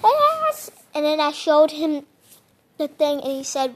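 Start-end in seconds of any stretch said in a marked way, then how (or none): 0.0-0.7 excited yes. Retold or imitated. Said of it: "And I'm like".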